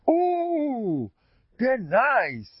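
high drawn-out ooh of delight; mock-delighted squeal of admiration; wife's delighted gush. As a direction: gush over a gift, pitch swooping up high. Wife delighted by flowers.

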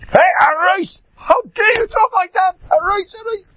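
very high, loud theatrical outrage; screaming scolding. Acted. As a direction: scream an indignant rebuke at your assistant. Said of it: "The Rav screams at him".

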